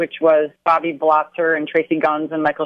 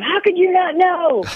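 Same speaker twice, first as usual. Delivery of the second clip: indignant fans; 12 semitones higher, slower than usual. Retold or imitated. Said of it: "fans who are going".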